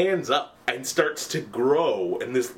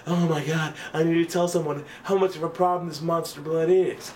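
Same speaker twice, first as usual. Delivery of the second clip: higher-pitched, mildly panicked retelling; mock freak-out; 5 semitones higher than usual. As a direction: imitate a kid freaking out. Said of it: "he's freaking the fuck out".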